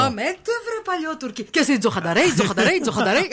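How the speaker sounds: high declamation turning into repeats; poem as proximity alert. Pretend. Host trying poem as reversing alert, high declamation. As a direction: declaim it, then loop τζοχανταρέοι like a sensor. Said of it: "ταιριάζει κιόλας όταν πλησιάζεις".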